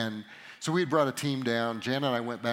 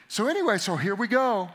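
usual speaking voice

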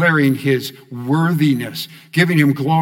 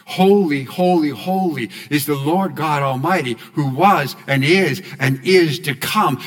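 louder declared refrain. Shown as a demonstration. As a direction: proclaim it out, louder. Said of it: "Say it again".

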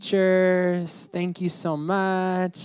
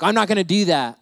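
loud, whiny mock refusal; defiant kid; 5 semitones higher, 7 dB louder than usual. Pastor voicing a child refusing parents, loud and defiant. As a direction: dig in like a stubborn teen. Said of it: "you're like".

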